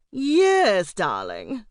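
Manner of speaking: hammed-up film-diva drawl; Missus Salome Otterborn. A narrator's reading. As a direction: start high and loud, then drop dramatically. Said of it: "in her best impression of Missus Salome Otterborn".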